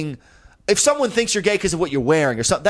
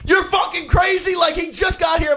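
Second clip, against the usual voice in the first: exasperated shout; 13 semitones higher than usual. Retold or imitated. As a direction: yell it at her, fed up. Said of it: "he's just like".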